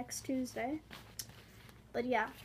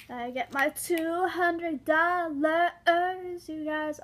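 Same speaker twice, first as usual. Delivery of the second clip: excited; 4 semitones higher, 7 dB louder, much slower than usual. Real, not acted. Excited about birthday money.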